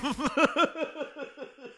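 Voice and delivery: high, breathy giggle. Real, not acted. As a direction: let out a real high laugh. Laughing after the bit.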